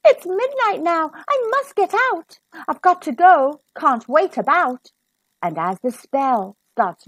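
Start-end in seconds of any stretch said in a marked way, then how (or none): 0.0-4.9 high, urgent hurry; a girl who must flee now; panicked rush. Acted. Cinderella panics as midnight strikes, high and hurried.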